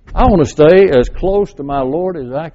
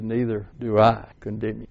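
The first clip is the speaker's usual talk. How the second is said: tender pardon; 6 semitones lower, 10 dB quieter than usual. Retold or imitated. Jesus voiced in Bible story.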